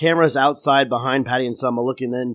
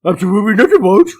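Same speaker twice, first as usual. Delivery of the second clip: loud, higher dog-like voice; 7 semitones higher, 8 dB louder, much faster than usual. Acted. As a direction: innocent pup denying everything. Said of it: "still in his dog voice".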